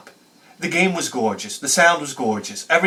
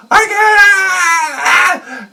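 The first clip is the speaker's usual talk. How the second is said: put-on high, loud crying wail of a little girl; crying shout; 7 dB louder than usual. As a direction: wail it through tears, half laughing. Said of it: "'Cause she was crying".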